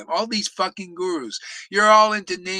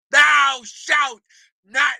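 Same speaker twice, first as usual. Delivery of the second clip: loud, higher booming decree; shouted commandment; 4 semitones higher, 6 dB louder, much slower than usual. Acted. Preacher booming the Bible commandment at idol worshippers, shouting. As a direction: thunder it like the Lord. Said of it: "Shout that".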